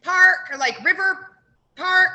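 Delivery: high, loud panicked yelling; loud high screeched directions; mock scream. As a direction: shout it like a confused drunk caller. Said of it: "She's screaming".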